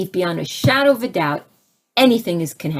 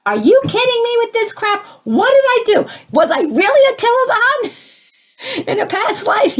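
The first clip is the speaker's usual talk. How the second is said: much higher, louder mock rant at the heavens; retold yelling; 14 semitones higher, 4 dB louder than usual. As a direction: replay the angry outburst. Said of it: "and I'm yelling at".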